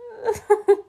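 squealing laugh; high breaking laugh. Real, not acted. Laughing at own reading.

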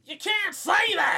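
high, loud Southern holler; Southern accent shout. Acted. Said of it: "got into the Southern accent".

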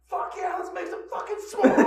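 high, loud victory shout; jubilant yell. Retold or imitated. Fans celebrate the Rangers' overtime win, jubilant high yell. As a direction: whoop it up like a winning fan. Acting out the celebration after winning.

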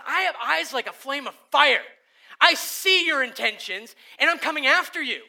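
loud, stern voice of a judging Jesus; Jesus as fierce judge, loud and stern; thunderous Jesus. Acted. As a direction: proclaim it like fire and judgment. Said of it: "And Jesus says".

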